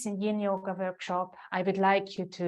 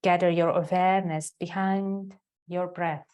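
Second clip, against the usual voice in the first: guided meditation. Real, not acted. Yoga teacher guiding students into the heart center, guided meditation. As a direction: lead them inward, leaving space after each phrase.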